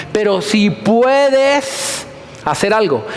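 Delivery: slow, drawn-out doubtful "si puedes"; doubting father. Retold or imitated. Preacher mimics the doubting father, slow and drawn out. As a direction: stretch "puedes" slowly, full of doubt. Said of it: "Mire el atrevimiento de este personaje".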